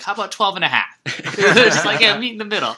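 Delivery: laughing imitation of De Niro; laughing mock quote. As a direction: giggle out the compromise line.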